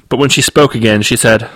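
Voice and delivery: usual speaking voice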